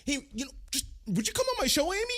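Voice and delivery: high-pitched, offhand mock ask; casual mock invitation. Host mimicking a random invite to his guest, mock casual.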